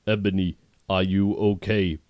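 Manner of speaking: ordinary delivery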